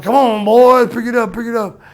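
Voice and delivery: yelling rider. Retold or imitated. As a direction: call out loudly, imitating a man on horseback.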